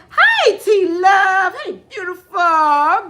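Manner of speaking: loud, high, drawn-out hello; excited greeting. Real, not acted. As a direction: sing out a delighted welcome to a newcomer. Calls out to arriving viewer.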